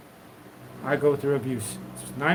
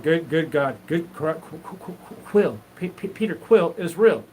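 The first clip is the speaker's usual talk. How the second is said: stammering exclamation; 2 semitones higher than usual. Retold or imitated. Stammering excited retold exclamation.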